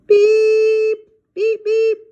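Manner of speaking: very high, loud beeps; loud toy horn beeps. Acted. Monkey honking the toy horn at the reveal, loud and high.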